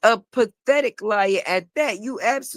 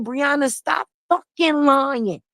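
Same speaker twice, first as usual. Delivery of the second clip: quoted cursing out; 4 semitones higher, slower than usual. Retold or imitated. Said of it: "She said".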